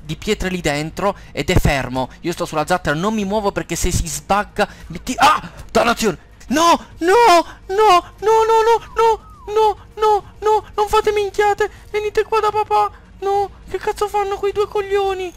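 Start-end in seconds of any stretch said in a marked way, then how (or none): 5.2-15.3 very high loud frantic yells at the creatures; shrill desperate calling back; panicked screaming. Real, not acted. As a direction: scream as they run to their deaths. His creatures run into danger.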